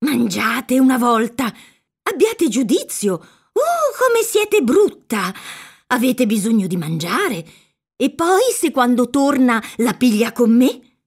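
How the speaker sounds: put-on high-pitched, exasperated old woman's wheedling; fretful, swooping scolding; peevish pleading. A narrator's reading. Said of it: "sempre con un tono di supplicazione stizzosa".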